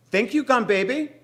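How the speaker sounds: high, loud thank you; furious yell. Acted. Moesha yelling thanks at the gum baby. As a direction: scream it at the doll.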